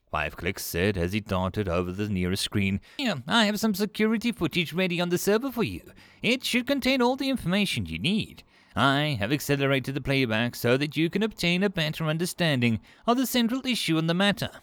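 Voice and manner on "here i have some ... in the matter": high-pitched, busy creature voice; eager small alien. Acted. Five Clicks offers security footage to Kolch, eagerly. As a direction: chatter helpfully in a high alien voice.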